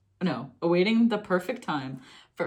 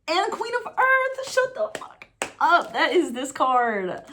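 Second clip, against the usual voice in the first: high-pitched squeal of disbelief; astonished; 10 semitones higher, 4 dB louder than usual. Real, not acted. Reader pulls Queen of Earth for earth signs, astonished. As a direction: burst out in thrilled shock.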